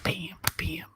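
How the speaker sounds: breathy whispered punch sounds; mock whispered bam bam; whispered sound effect. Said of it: "Just punch 'em in the face".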